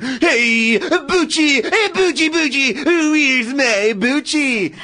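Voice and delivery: high, sing-song calling for a dog; John Travolta impression. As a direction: coo the dog's name up high. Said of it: "It's a really good Travolta impression".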